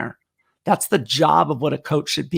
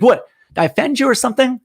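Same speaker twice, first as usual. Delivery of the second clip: higher, fast puzzled "what, did I offend you"; confused retort; 8 semitones higher, 4 dB louder, faster than usual. Retold or imitated. Running partner asks the coach who stopped mid-interval, quick and puzzled. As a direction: rattle it off, baffled and a bit defensive.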